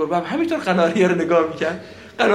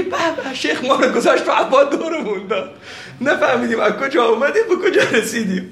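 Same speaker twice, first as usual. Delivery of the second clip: high, louder amused quote through laughter; laughing mock quote; 7 semitones higher, 4 dB louder than usual. Teacher mocks students dizzied by the argument, laughing. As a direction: laugh as you say it.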